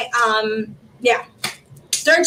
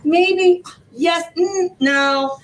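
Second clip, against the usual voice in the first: teasing hesitation; 5 semitones higher, much slower than usual. Retold or imitated. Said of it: "And I was like".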